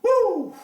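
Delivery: high, loud whoo; whoo exclamation. Real, not acted. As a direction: let out a sharp whoo. Exclaims over the Minnesota case.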